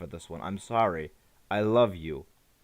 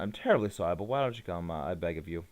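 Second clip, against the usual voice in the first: lightly put-on British accent; apologetic British professor, accent lightly done; 6 semitones lower, 3 dB quieter, faster than usual. Professor begging his Pokemon to come, a hint of British accent. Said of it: "I imagine he has a British accent".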